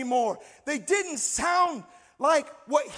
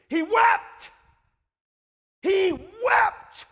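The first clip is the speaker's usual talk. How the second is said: emphatic cry; 6 semitones higher, 4 dB louder, much slower than usual. Real, not acted. Contrasts sniffles with real weeping.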